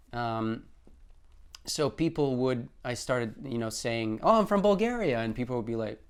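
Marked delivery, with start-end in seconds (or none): 4.2-5.2 high, loud, proud "I'm from Bulgaria"; cheery mock introduction; proud announcement. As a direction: announce it brightly, proud of home.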